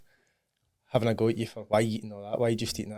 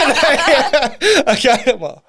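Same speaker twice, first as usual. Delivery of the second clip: laughing confession; 7 semitones higher, 8 dB louder, slower than usual. Real, not acted. Laughing at own mirror habit.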